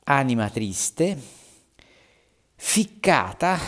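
slow, rising declamation; dramatic verse reading. Acted. Teacher reading the damned soul's verse, slow and high. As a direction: stretch each word for effect.

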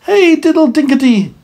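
high, loud squeaky mouse voice; high squeaky mouse reply; little mice answering. A narrator's reading. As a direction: chirp it back like tiny mice. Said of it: "answered the little mice".

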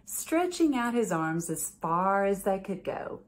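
gentle bedtime story read-aloud; reading to children. A narrator's reading. Judge reading a picture book to children, gentle storytelling.

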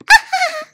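high, loud "ha-ha" peal; short bright storybook laugh. A narrator's reading. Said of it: "laughing brightly".

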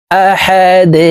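"আহাদ" intoned with a bounced, echoing d; qalqalah. Shown as a demonstration. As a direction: push off the final d of "আহাদ" like "de". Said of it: "ধাক্কা দিয়ে".

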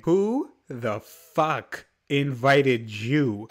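very slow, drawn-out indignant question; slow, deliberate outrage; appalled confrontation. Acted. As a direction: stretch out each word in disbelief. Confronting the unwanted guest directly.

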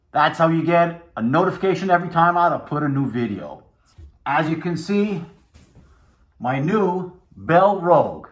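muffled behind chin bar; through a helmet muzzle. Real, not acted. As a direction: mouth covered by face guard. Seen 0: Helmet muzzle covers his mouth in every frame where he appears.

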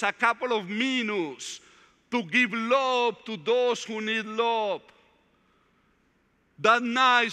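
earnest preacher building toward the point; preaching testimony. Acted. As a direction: testify to the brothers with conviction. Preacher exhorting congregation.

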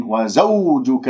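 وزوجك with a slow, smooth gliding w; soft waw. Shown as a demonstration. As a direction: draw out the waw of وزوجك without pressing it. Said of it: "واو لين". (واو لينة ممدودة في وزوجك)

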